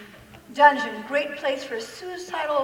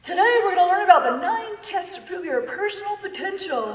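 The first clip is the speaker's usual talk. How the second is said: higher-pitched mock pastor voice; pastor's announcement; 6 semitones higher, 4 dB louder than usual. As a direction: announce it like an overeager preacher, mimicking. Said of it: "the pastor gets up and goes".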